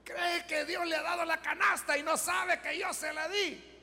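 high, gloating jeer with a put-on laugh; hammed-up derisive crowing, pitched high; mocking laughter. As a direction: play the scoffer crowing over his trick. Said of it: "se reía y decía".

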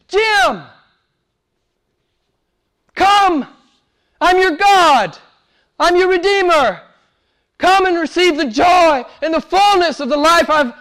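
a hint of God calling, high and loud. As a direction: call him like God inviting. God calling Jim by name.

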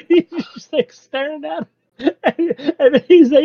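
giggling through the words; real giggles breaking in; laughing. Real, not acted. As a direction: talk while laughing high.

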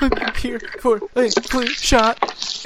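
high, drawn-out tearful whimper; mock crying. Man mimicking Katie's tears at the clinic, mock crying. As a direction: imitate someone sobbing through a simple request. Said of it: "She's crying in the car".

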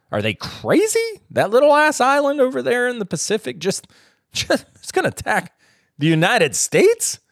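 can't believe it, voice jumping up; incredulous. Retold or imitated. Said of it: "it was like".

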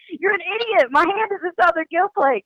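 high-pitched, fed-up snap; exasperated retort. Retold or imitated. Guide wishing she could tell off a troll, in a high exasperated snap. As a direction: let the frustration squeak out. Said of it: "but I wanna be like".

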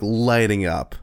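usual speaking voice

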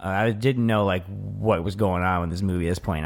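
ordinary talk

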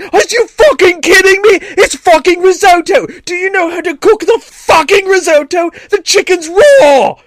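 enraged chef screaming at the top of the range; loud, high, hammed-up British chef rant; Gordon Ramsay. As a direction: blow up at a useless cook. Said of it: "that's like Gordon Ramsay going".

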